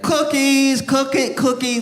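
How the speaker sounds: put-on high sing-song little girl hawking cookies. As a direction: chirp it out like a kid selling cookies. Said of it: "this Girl Scout is like".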